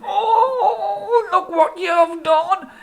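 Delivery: high crying yell over a sausage nose; yelling in dismay. Acted. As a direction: howl it in comic despair. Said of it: "he yelled".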